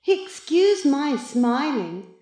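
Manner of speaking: put-on smug cat in boy's body. A narrator's reading. The other Maurice mocks the boy, smugly. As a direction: gloat with slow, mock politeness.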